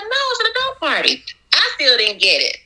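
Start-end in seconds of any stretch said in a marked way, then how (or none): 0.0-1.2 much higher, fast, breezy customer reply; mock customer voice. Baker imitating the customer's high breezy reply. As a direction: play the customer answering high and quick. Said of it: "She was like".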